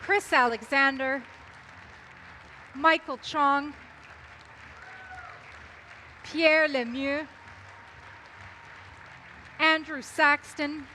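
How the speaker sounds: announcer calling names; announcer introductions. Acted. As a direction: introduce each candidate to applause. Calls out candidates onstage.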